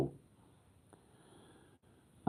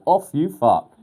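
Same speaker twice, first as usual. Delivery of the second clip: louder, higher mock quote of his wife; imitating wife; 11 semitones higher, 12 dB louder, slower than usual. Husband mimicking his wife's rude reply, louder and higher. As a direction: throw her words back, louder and higher. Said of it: "She said".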